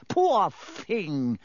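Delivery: mock sympathy, clearly insincere; unconvincing pity. A narrator's reading. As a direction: pretend to feel sorry and fail. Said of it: "he added unconvincingly".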